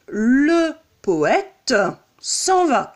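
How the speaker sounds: poète with its last E sounded, "po-è-teu"; le poète s'en va, every E voiced; exaggerated final E. Shown as a demonstration. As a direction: deliberately overdo the E at the end of poète. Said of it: "j'exagère, euh, volontairement pour voir que le E".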